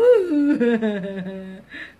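loud, squeamish laugh; nervous laugh. Real, not acted. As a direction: laugh off the fright. Squeamish at the live shells.

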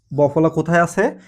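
normal talking voice

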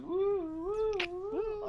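high hooting ghostly woo; Phantom ghost woo. Retold or imitated. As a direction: hoot like a spooky phantom. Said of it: "the Phantom".

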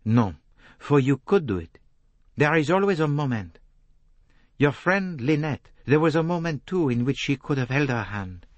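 urgent Poirot. A narrator's reading. Poirot urging Jacqueline that she could still stop, insistent. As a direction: press the point with firm conviction.

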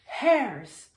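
loud call with falling tune; eager storybook call. A narrator's reading. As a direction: call across to get their attention. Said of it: "called the bear".

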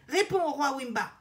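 loud, pressing order; insistent. A narrator's reading. Parrot ordering the queen to answer the king, insistent. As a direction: demand an answer at once. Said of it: "insiste Père Cao".

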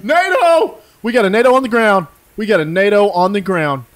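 loud, high, fast callout; excited callout. Real, not acted. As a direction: alert the chat with excitement. Spotting game target excitedly.

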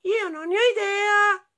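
very high squeaky squirrel voice. A narrator's reading. Squirrel answering the moose, high squeaky voice. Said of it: "dice scoiattolo".

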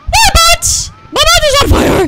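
high, loud panicked shriek; panicked scream. Real, not acted. Gamer shrieking as the character catches fire, high scream. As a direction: yelp like you're really burning.

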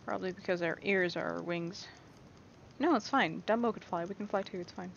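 ordinary talk

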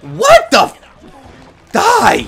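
drawn-out high scream then a shouted die; enraged yell. Real, not acted. Gamer yelling at the opposing player, enraged. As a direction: explode at the screen. Raging at game play.

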